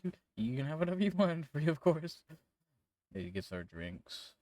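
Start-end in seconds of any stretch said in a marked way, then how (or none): 0.0-2.2 high, stammering, panicky appeasement; loud, high, flustered stutter; frightened bartender. Acted. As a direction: trip over the words, scrambling to please. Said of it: "who is frightened".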